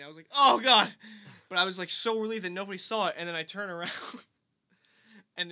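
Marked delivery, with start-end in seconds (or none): 0.3-0.9 loud, higher pained cry; hammed-up tearful outburst; mock crying. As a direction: wail it, re-enacting the hurt. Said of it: "I was, like, crying".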